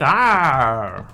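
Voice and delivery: gruff pirate growl; loud pirate bark. Pretend. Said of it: "Sound like a pirate?".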